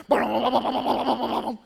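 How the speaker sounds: loud rapid-fire "bah" noises; imitated frantic chomping. Player hammering away at Hungry Hippos, frantic noise. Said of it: "and you're like".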